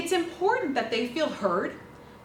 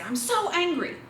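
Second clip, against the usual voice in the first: mock angry; 6 semitones higher than usual. Shown as a demonstration. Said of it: "they're really upset and they say".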